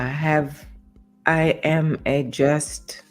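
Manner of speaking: usual speaking voice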